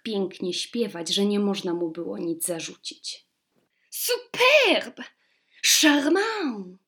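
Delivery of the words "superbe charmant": put-on high French-accented gushing; French exclamation. A narrator's reading. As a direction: court ladies gushing in French. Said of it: "zawołały damy dworu, gdyż paplały wszystkie po francusku".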